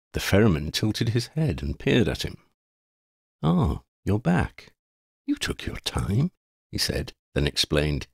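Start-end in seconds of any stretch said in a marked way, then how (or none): 3.4-6.3 slow, drawn-out mock reproach; jesting. A narrator's reading. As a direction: tease an old traveller, slowly. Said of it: "I am making an old wayfarer's jest".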